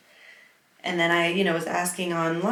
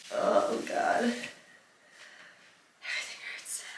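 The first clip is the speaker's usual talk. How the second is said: breathless pained whisper; 5 semitones higher, 6 dB quieter than usual. Real, not acted. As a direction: whisper it, worn out.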